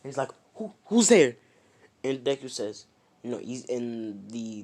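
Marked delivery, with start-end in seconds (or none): 0.6-1.4 high, loud startled stammer; startled questioning. Retold or imitated. Deku to a voice out of nowhere, startled. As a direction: call out, caught off guard.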